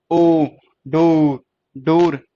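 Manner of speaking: ओ, डो, डोर built up with long ओ; sounding out. Shown as a demonstration. Teacher building the word from its sound, sounding out. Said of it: "ओ की sound आ रही है ना डोर में".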